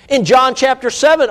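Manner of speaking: ordinary talk, nothing marked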